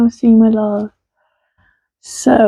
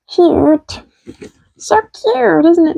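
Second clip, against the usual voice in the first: high puppet voice; 8 semitones higher, slower than usual. Acted. Puppet voice cooing.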